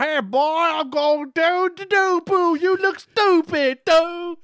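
very high, slow, loud yelled caricature; Grandmaster Sexy. Retold or imitated. Mocking the wrestler picking up the mic, high slow holler. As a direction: holler it as a drawn-out squealing taunt. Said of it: "That's how he sounds".

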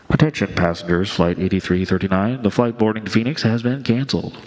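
airport announcement. Retold or imitated. Mimicking the gate speaker cancelling the Phoenix flight, PA style. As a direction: read it like a bored terminal loudspeaker. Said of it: "he hears what you, you and I have heard before".

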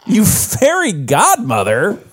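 high, drawn-out overacted movie insult; milk the silly curse slowly, pitched high; hammed-up mock insult. Hams up the dubbed insult.

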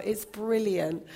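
ordinary talk, nothing marked

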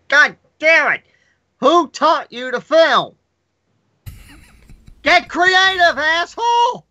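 high-pitched exasperated rant, cracking; hammed-up outrage in a high, cracking voice. Critic berating a lazy camera director, high cracking mock outrage. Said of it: "I heard your voice crack".

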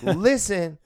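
a touch of sassy finger-snapping attitude; lightly mocking diva tone. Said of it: "snap my fingers, do a little twist".

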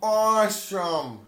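loud, slow drawn-out awesome; mock impression. Friends trade impressions, slow loud drawn-out awesome. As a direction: stretch it out big and loud. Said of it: "how many impersonations I can kinda do".

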